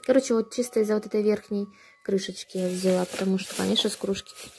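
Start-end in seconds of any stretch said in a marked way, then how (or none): none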